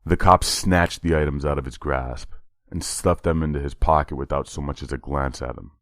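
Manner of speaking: ordinary delivery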